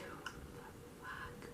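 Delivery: drawn-out, barely audible whisper; whispered disbelief. Retold or imitated. Staff behind face masks, whispered disbelief. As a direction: whisper it as if hidden behind a mask.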